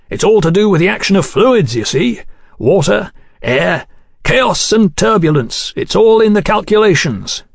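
high, animated engineer; Godwin, keen engineer. Acted. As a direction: explain it with rising enthusiasm.

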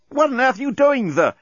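very high-pitched woman's outburst; exclaiming. A narrator's reading. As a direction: squeal it in indignant shock. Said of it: "exclaimed Lucy".

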